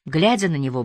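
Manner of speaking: plain reading voice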